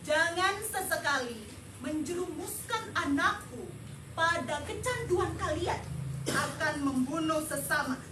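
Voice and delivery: normal talking voice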